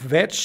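"veg" as one isolated syllable, VECH; silent E. Shown as a demonstration. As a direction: stop "veg" on the ch sound, no E after. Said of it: "no suena esta E. Es veg". ("veg" aislado, sin E final)

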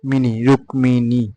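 slow, drawn-out রুক্মিণী, "ruk-mee-nee"; syllable by syllable. Shown as a demonstration. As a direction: build রুক্মিণী from its end, m clearly sounded. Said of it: "উচ্চারিত হবে মি". (রুক্মিণী ভেঙে ধীরে, "রুক্-মী-নী")

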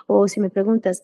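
usual speaking voice

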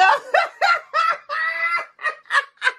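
long, high squealing laugh; elated laugh. Real, not acted. Scratcher hitting bingo, high elated laugh. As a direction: cackle high in triumph.

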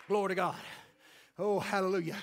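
ordinary delivery

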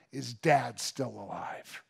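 low, soft and slow; hushed, tender question. Acted. Joseph asks brothers after their father, hushed slow question. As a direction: ask it slowly, almost afraid of the answer.

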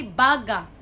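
"baga" with loud, long BA; BA-ga, falling on "baga"; stress on first syllable. Shown as a demonstration. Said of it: "the first one is".

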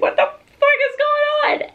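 very high, loud squeal of disbelief; comic high-pitched bewilderment; baffled outburst. Retold or imitated. Laboring woman baffled by sobbing family, high outburst.

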